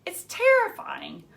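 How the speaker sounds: high, trembling protest; shaking voice. Acted. Girl protesting the poem in class, voice shaking. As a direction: let the fear crack through. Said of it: "My voice was shaking".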